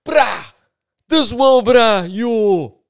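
loud, very high-pitched excited God; God as a bruh. Acted. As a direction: holler like God hyping up a mate. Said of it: "why God suddenly has my accent".

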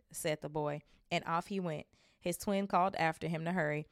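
usual speaking voice

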